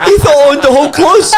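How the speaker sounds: laughing through the punchline, high; can barely get it out for laughing. Real, not acted. Teller laughing at memory.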